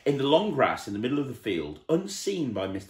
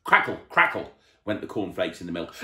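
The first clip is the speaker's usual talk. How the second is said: plain reading voice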